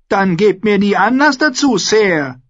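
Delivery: high-pitched royal warning; high, imperious comeback; boy king's retort. A narrator's reading. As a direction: answer sharply in a high king's voice. Boy king retorts to knight.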